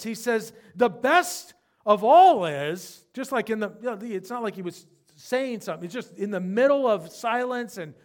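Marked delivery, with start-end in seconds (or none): 0.8-3.0 loud, slow cry of joy; cried out. Retold or imitated. Dying preacher crying out to loved ones, loud and slow. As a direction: cry it out from a deathbed. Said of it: "he just cries out and he says this".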